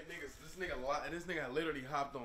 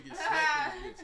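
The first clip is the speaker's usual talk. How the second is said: laughing; 11 semitones higher, 6 dB louder than usual. Real, not acted. Laughing about a fight.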